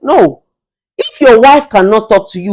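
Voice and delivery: normal talking voice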